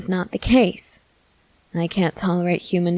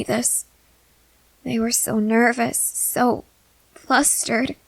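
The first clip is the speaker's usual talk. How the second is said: put-on nervous young companion; 5 semitones higher than usual. Companion confessing fear of feeding to the vampire, nervous and higher. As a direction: let the worry sit under every line. Companion notes friend's feelings.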